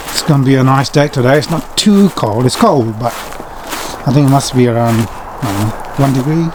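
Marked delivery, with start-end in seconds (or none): none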